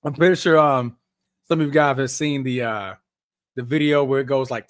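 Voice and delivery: ordinary delivery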